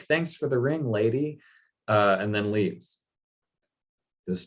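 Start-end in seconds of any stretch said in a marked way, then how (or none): 0.0-1.4 higher, breezy thanks; innocently grateful, no idea he stole it; cheerful clueless thanks. Retold or imitated. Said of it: "is like".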